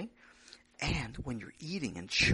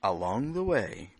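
storytelling narration; 8 dB louder than usual. A narrator's reading.

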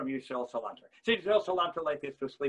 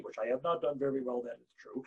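ordinary talk, nothing marked

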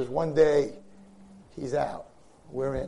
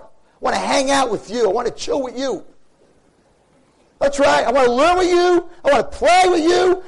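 raised, longing prayer to a father; heartfelt preaching; 9 semitones higher, 7 dB louder than usual. Acted. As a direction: plead warmly and intensely for closeness.

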